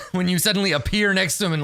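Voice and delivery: ordinary talk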